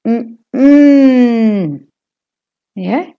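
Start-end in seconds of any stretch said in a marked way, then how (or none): 0.1-1.9 short hum then long higher hum; hummed stress pattern. Shown as a demonstration. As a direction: hum "Mm-mm", second one held and stronger. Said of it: "mm in the middle is longer and louder".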